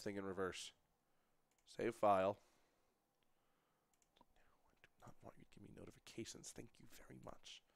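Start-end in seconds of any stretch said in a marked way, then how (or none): 4.2-7.6 barely voiced aside to the screen; whispered mutter. Real, not acted. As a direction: whisper it under your breath, annoyed. Muttering at computer popup.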